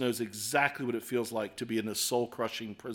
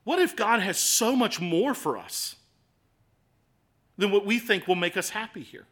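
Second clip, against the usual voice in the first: ordinary talk, nothing marked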